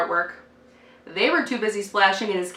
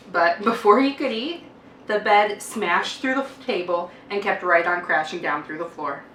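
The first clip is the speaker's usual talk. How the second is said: plain reading voice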